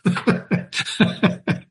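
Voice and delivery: soft breathy chuckle; a short run of quiet, real chuckles; laughing. Real, not acted. Teacher amused by the passage he just read, laughing. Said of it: "I'm laughing because".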